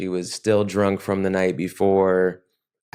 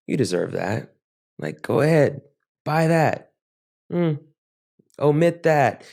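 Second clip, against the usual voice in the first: acted-out temptation, lightly egging oneself on; coaxing inner addict voice; 6 semitones higher than usual. Addiction talking a man into small indulgences, coaxing. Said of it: "getting louder".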